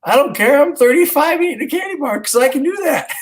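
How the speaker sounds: giddy laughing dad refusing to share; laughing defiance. Retold or imitated. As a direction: crack up while refusing to care. Defiant dad, retold argument.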